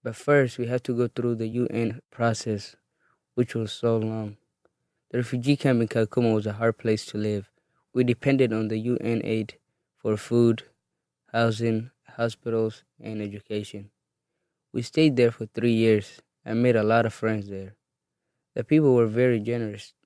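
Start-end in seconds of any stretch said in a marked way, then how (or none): none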